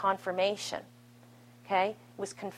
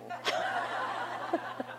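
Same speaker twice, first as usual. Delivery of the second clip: quiet breathy chuckle; soft self-deprecating laugh; soft airy laugh; 3 semitones higher, 10 dB quieter than usual. Real, not acted. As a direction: laugh at yourself softly. Laughs at herself.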